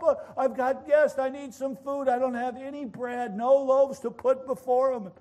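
high, pressing explanation; urgent pleading. Acted. Acted plea at friend's door.

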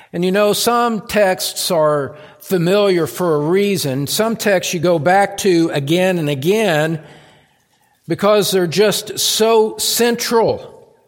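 impassioned preaching. Real, not acted. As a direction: preach it out to the whole congregation. Preaches at full force.

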